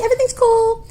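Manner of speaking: high, sing-out mock reassurance; feigned nonchalance. Feigned ease in awkward moment.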